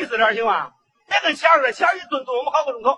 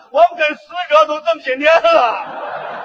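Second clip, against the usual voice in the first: slow, loud, weighty pronouncement; solemn fat chairman; 2 semitones higher, 6 dB louder than usual. Acted. Union chairman solemnly telling the bride of his loyalty, slow and booming. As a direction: drag it out like a pompous official.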